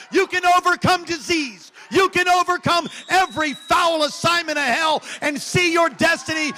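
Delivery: loud, high-pitched rallying preacher; rousing pulpit cry building to hallelujah; full-force preaching. Acted. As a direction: drive every exhortation home at a shout.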